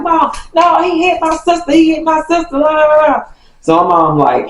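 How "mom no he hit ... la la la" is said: high pleading voice of her younger self; mocking her younger self pleading; whiny kid protesting. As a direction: beg mom, high and whiny. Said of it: "So I'm just like".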